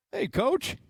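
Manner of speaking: ordinary delivery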